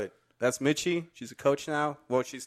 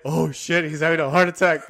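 louder, higher mock panic; retold alarm; 5 semitones higher, 6 dB louder than usual. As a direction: re-enact the panicked reaction to an emergency. Retells panic at mock code.

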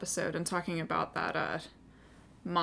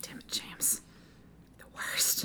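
unvoiced grumbling whisper; whispered curse; 10 dB quieter than usual. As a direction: curse him under your breath. Muttered curse at James.

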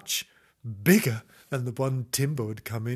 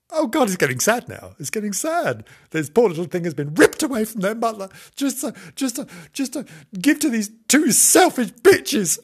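high, loud mock-distraught outburst; carried away; 9 semitones higher, 7 dB louder than usual. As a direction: build into overwrought outrage at the sad tale. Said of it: "I'm carried away there".

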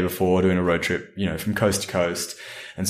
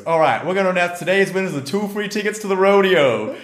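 high-pitched imitation of a prize announcer; hammed-up, high announcer call; microphone announcer; 9 semitones higher than usual. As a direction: announce raffle winners like a Texan host. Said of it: "pulls out the microphone and goes".

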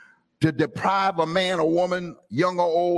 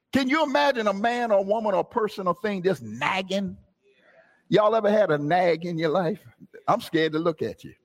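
ordinary talk, nothing marked

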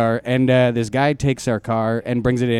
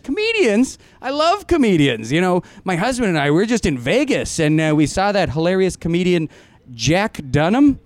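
high-pitched, gushing delight; light up with starstruck excitement; thrilled fan; 6 semitones higher than usual. Retold or imitated. Farm woman meeting comedians on her doorstep, thrilled.

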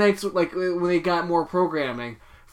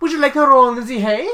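lightly voiced flirtatious offer, pitched higher; Inga; 6 semitones higher, 3 dB louder than usual. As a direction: lilt it up like an invitation. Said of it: "and she's like".

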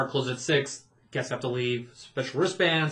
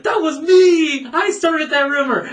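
much higher, louder eager blurt; eager confession; 13 semitones higher, 10 dB louder than usual. Retold or imitated. Cast member almost confessing at huddle meeting, eager blurt. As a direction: blurt it out like a proud kid. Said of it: "I was about to raise my hand".

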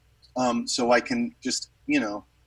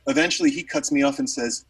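ordinary talk

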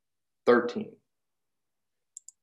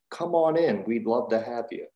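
ordinary talk

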